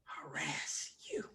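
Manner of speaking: hushed, slow, innocent comic whisper; mock innocent whisper. Quoting a comedian's harassment joke, hushed mock innocence. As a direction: breathe it like a fake-innocent punchline. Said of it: "Patrice O'Neal doing a sexual harassment joke".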